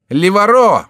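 high, very loud exclamation; crying out. A narrator's reading. Antraguet spotting his friend, crying out high and loud. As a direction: shout the friend's name in recognition. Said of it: "вскричал Антраге".

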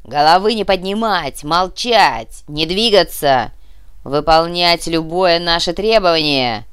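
loud, drawn-out orders to captives; shouted command. Acted. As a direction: lay down the rules loudly, slowly. Terrorist commands the hostages.

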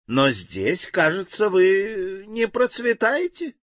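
sly, teasing smirk; mocking. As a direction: needle him with a grin. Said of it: "девочка усмехнулась".

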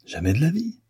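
high, forceful denial; convinced outcry; emphatic exclamation. A narrator's reading. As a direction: deny it with full conviction. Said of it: "s'écria l'artiste avec conviction".

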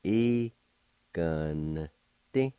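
"E, gun, ti" slowly, each sound apart; syllable by syllable. Shown as a demonstration. Teacher showing the second part of the word, one syllable at a time. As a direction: pause between the drawn-out syllables. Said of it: "pronounce the N sound with the G V like this".

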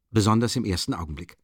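ordinary talk

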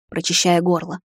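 plain reading voice

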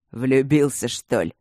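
put-on creaky, demanding old voice; demanding creak. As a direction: creak out a pushy question. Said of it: "требовательно проскрипел Ипполит".

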